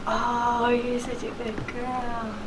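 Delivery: hushed, slow praise for the pet; soft dog praise. Real, not acted.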